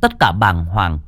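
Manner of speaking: steady storyteller reading a ghost tale; story narration. A narrator's reading. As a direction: tell it like a spooky radio story. Reads horror story narration. (giọng kể chuyện ma)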